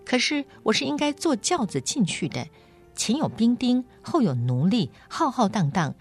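haughty, indignant complaint, pitched high; mildly fierce storybook girl, voice swooping up; fierce retort. A narrator's reading. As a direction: complain crossly as a noble girl. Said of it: "但口气很凶".

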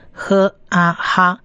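"呵啊哈" slowly, h, long a, then joined as ha; syllable blending. Shown as a demonstration. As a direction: sound out "呵啊哈" piece by piece. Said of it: "它的发音是发呵的音". (慢慢拼读“呵啊哈”：先呵，再啊，合成哈)

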